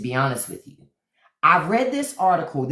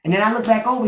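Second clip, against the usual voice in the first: ordinary delivery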